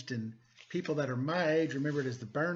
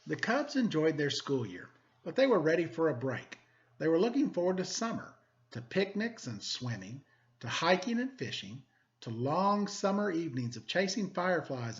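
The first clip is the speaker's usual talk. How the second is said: lively, expressive picture-book reading; storybook narration for students; reading to children; 3 semitones higher than usual. Acted. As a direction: read it like story time for the class. Teacher reads picture book aloud.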